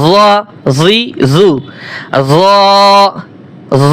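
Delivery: emphatic but soft জ; soft heavy za. Shown as a demonstration. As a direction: recite জ full and rounded, spaced. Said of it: "জ হরফ নরম হবে".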